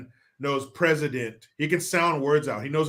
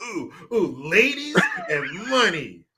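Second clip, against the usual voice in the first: mock emphasis; 5 semitones higher than usual. Mocking the letter's emphasis.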